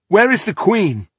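sharp, loud, high demand; angry demand. Acted. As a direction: snap the question out angrily. Woman demands after kicking wall.

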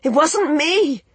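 high, drawn-out shout of protest. A narrator's reading. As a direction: shout your denial across, pitched high and slow. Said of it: "she shouted".

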